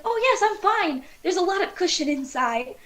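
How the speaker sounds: high-pitched cheery reassurance; happy alien. Acted. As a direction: brush off the crash cheerfully. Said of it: "He's just very happy".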